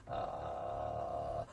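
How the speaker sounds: "Ah" in low creaky fry; vocal fry. Shown as a demonstration. Coach showing the fry step first, creaky. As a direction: let "Ah" crackle down in fry. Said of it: "So when you go".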